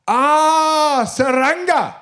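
high, loud "ah" of sudden understanding; a farmer finally getting it; high, loud mock "ah, Saranga"; father realising. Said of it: "the father of the family goes".

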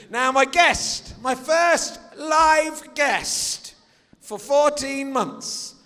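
slow drawn-out showman build-up; announcer voice. Acted. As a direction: stretch it like a game show host. Host announcing live guest.

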